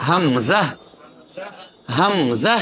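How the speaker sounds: হামজা in two measured syllables, rising at the end; no elongation. Shown as a demonstration. Teacher models হামজা for students, no elongation. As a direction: recite হামজা without a long final vowel. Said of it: "কোনোটাই কি হবে না".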